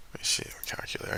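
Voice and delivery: fast whispered aside; whispering. Real, not acted. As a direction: whisper it quickly to yourself.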